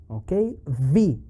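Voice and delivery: usual speaking voice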